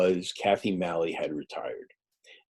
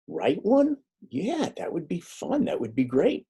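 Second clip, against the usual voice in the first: mock delighted, pitched up; excited; 10 semitones higher than usual. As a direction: retell your own eager yes. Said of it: "And I said".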